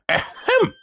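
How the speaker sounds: a high, pompous "ahem"; a hammed-up pompous throat clearing. Old lawyer clears his throat.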